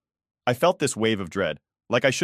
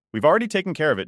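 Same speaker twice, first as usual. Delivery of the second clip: dismissive tone; 7 semitones higher, 4 dB louder, much faster than usual. Acted. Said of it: "in this dismissive tone".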